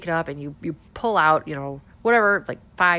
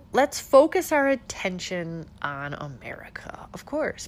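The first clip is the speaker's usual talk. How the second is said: whispering. Real, not acted. Sarcastic whispered aside.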